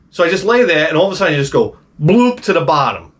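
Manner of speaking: usual speaking voice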